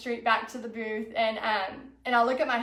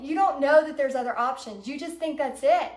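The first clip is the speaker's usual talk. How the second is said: ordinary delivery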